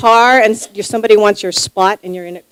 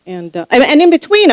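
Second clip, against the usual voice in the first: ordinary delivery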